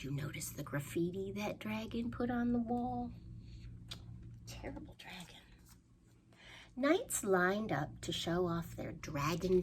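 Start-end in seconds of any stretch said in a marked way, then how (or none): 4.5-5.4 mock disapproving whisper; soft scolding whisper. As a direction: whisper it, shaking your head. Hushed disapproving aside.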